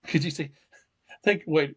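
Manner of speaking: ordinary delivery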